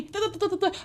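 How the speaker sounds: high, soft mocking du-du babble; mock chatter noise. Vlogger mocking a nagging woman, high babble. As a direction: imitate endless yapping.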